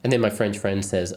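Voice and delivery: ordinary delivery, nothing marked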